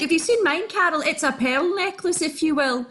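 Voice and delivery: usual speaking voice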